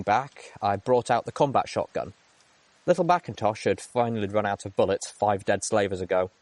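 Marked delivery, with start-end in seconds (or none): none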